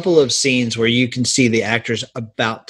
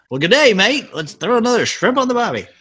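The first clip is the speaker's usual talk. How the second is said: higher-pitched mock Aussie greeting; mock Australian accent; 7 semitones higher than usual. Lloyd greeting the Austrian lady, in mock Australian accent. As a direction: put on a quick Australian accent.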